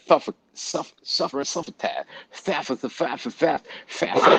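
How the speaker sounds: spits out a mocking lisp on s sounds, suffering said as thuffer, high; mock old-timey lisp. Mocking how people supposedly talked, in a sputtering lisp. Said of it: "That's how they were talking".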